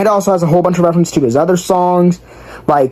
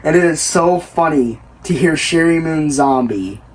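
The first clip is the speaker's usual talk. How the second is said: normal talking voice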